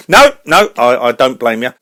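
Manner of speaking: normal talking voice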